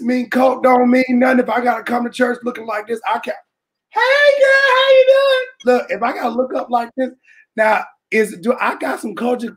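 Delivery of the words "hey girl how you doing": high loud falsetto hello; fake cheerful greeting. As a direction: squeal a fake bright greeting to hide the bruise. Imitating bruised woman greeting.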